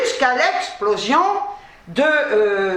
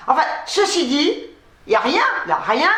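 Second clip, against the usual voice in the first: normal talking voice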